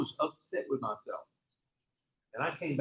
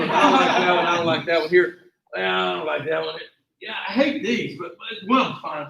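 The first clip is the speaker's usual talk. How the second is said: louder fussy griping; picky mock complaint; 3 semitones higher, 8 dB louder than usual. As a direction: whine about every shoe. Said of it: "So we go".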